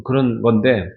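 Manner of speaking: ordinary talk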